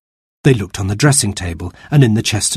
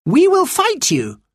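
put-on high girl's voice, firm refusal; a girl standing up to the chief; defiant girl; slower than usual. A narrator's reading.

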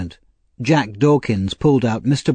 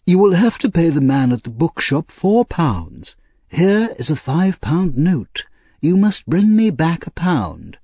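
put-on higher, animated old gentleman; Mister Brownlow instructing; 7 semitones higher than usual. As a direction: give careful instructions in a lifted, lilting voice.

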